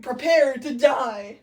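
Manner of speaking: loud, slow dramatic threat; mock menacing threat. Othello to waking Desdemona, mock menace. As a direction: declaim it slowly and loudly.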